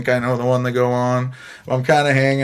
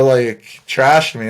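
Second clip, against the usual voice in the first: ordinary talk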